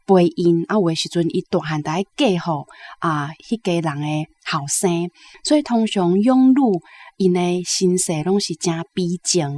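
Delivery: ordinary talk, nothing marked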